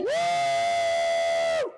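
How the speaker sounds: a very high, loud cheering whoop; excited whoop. Real, not acted. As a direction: let out a big celebratory woo. Hyping up a viewer shoutout.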